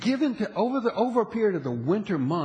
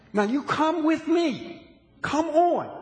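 high, drawn-out plea to follow; rally the listeners along; preacher calling the church to follow, fervent; urging call; 9 semitones higher, slower than usual. Real, not acted.

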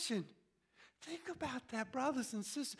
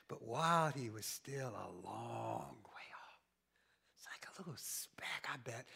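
whispered, hushed narration; hushed telling; 10 semitones lower than usual. A narrator's reading. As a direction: draw them in with a whisper. Hushed storytelling of distant son.

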